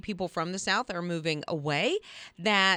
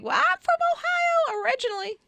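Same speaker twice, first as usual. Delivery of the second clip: retold protest; 5 dB louder than usual. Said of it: "And I'm like".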